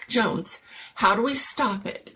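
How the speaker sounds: ordinary delivery, nothing marked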